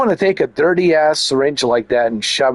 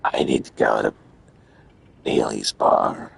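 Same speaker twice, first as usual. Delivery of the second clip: low mock voice; 5 dB quieter than usual. Voicing character in low voice.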